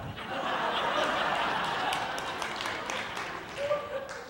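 high, airy mocking cackle; imitating an obnoxious laugh. Mocking the pastor who laughed at him, airy cackle. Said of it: "he stopped laughing like that".

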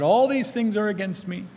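high, plaintive cry of woe; despairing lament. Pretend. Jacob lamenting his lost sons, despairing. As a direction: wail it, all is lost. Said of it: "He said".

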